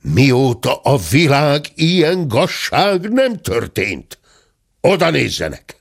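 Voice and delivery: high, indignant proclamation; acted scandalized bellow; outraged roar. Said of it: "ordította a tenyeres talpas vendég".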